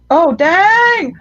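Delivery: loud, high, drawn-out whoop; hollering, impressed; wowed exclamation. Real, not acted. Exclaiming at a picture.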